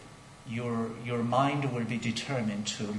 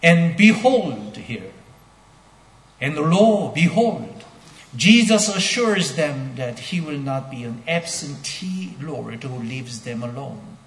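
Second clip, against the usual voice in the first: loud, high, fervent sermon delivery; ringing pulpit proclamation; preaching at full force; 6 semitones higher, 8 dB louder than usual. Acted. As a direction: preach it out to the whole church.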